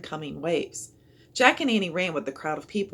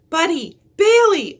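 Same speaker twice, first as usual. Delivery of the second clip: higher, much louder scream of names; 11 semitones higher, 13 dB louder than usual. A narrator's reading. Girl screaming for her dogs in the water, a high loud scream. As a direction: shriek for the lost dogs. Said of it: "a girl screamed".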